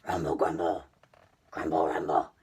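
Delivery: very low, loud rumbling beast voice; monster grumble. Acted. Storyteller voicing the hungry monster's deep rumble. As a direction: rumble it out like a big-bellied beast.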